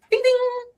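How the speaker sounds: high sung elevator chime; elevator ding. Retold or imitated.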